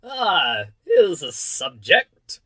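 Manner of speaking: loud, slow, grand royal exclamation; exclaiming king. A narrator's reading. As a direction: proclaim it loudly like a delighted monarch. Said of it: "the king exclaimed".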